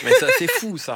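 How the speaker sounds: high, laughing disbelief; amused and astonished; laughing amazement. Real, not acted. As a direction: giggle through the surprise, way up in pitch. Amazed by forensic dream.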